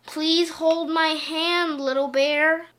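weary. A narrator's reading. Daddy Bear asks Little Bear to hold his hand, wearily. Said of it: "said Daddy Bear wearily".

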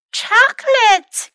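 high, loud squeal of delight; excited cry. A narrator's reading. Pretty fox spots the chocolates, high delighted cry. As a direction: cry out at a treat. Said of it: "cried the pretty fox".

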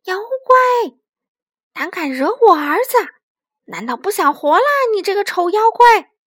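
high, loud roar at a monster. A narrator's reading. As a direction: bellow the threat like a protective mother. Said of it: "妈妈大吼一声". (大声怒吼威吓)

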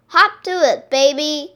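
high, loud spurring call. A narrator's reading. Mister Duck to lagging Baby, calling out. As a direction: holler to get a child moving. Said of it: "called Mister Duck".

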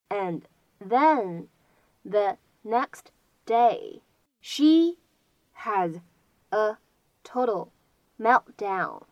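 slow, separated words with pauses. Shown as a demonstration. Teacher dictating the sentence to learners, word by word. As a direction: read it out one careful word at a time.

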